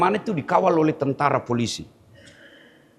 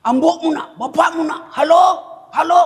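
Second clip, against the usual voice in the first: shouting into the phone; 11 semitones higher, 4 dB louder than usual. Retold or imitated.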